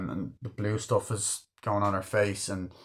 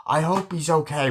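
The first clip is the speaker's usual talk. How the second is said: higher-pitched mock fretting; retold worry; 6 semitones higher, 3 dB louder than usual. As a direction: lightly imitate an anxious wife. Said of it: "she's getting all worried".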